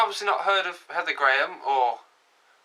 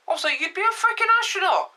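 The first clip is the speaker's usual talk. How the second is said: thrilled high squeal; 11 semitones higher than usual. Acted. Hyped about being astronaut.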